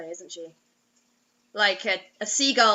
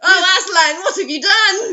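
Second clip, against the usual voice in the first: hammed-up dramatic lament to Aslan, louder; mock dramatic cry; 6 semitones higher, 10 dB louder than usual. Joking about Narnia, a hammed-up dramatic lament.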